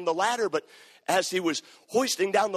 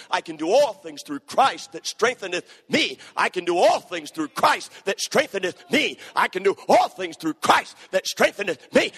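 louder each time, proclaiming; building declaration; 2 semitones higher, 6 dB louder than usual. Real, not acted. Preacher leading the verse in rising preach.

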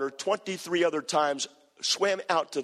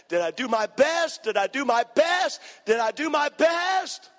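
desperate repeated plea, growing each time; rising anguished cry; 11 semitones higher, 4 dB louder than usual. Retold or imitated. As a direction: let the haunted question climb into a scream. Preacher retells haunted man's cry.

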